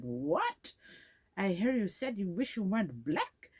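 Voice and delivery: high-pitched, incredulous "What?"; acted indignant shock. Senior girl confronting her in a closet, indignant shock. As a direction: leap up in pitch, imitating an outraged accuser.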